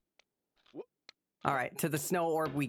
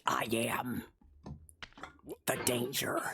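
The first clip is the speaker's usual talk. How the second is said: breathy movie-villain whisper; hammed-up whispered villain line; dramatic whisper; 6 semitones lower than usual. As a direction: whisper it like a threat. Quoting Breaking Bad line.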